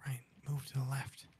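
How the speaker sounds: hushed unvoiced whisper to a teammate. Real, not acted. Gamer whispering a callout to his squad, whisper. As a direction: keep it to a breath so the enemy can't hear. Sneaking up in a shooter game.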